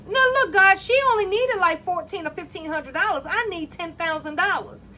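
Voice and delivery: half-joking outrage at being passed over; telling God it isn't fair; indignant plea to God. Retold or imitated. Complaining to God about overtime.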